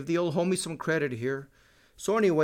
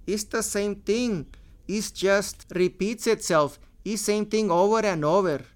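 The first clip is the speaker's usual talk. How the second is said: higher-pitched, put-on heavy Mexican accent; a comic impersonating a Mexican accent, pitched up and louder; George Lopez Mexican accent; 6 semitones higher, 4 dB louder than usual. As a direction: lift the voice and lay on a thick Mexican accent. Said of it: "that's my George Lopez impression of his impression of a Mexican with an accent".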